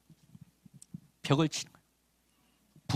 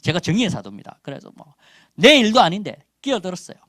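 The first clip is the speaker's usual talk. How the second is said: normal talking voice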